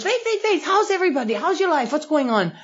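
high, gushing mock-friendly voice; sudden fake cheer. A friend suddenly gushing over the phone, high and mock-sweet. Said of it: "all of a sudden she goes".

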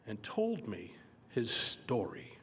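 normal talking voice

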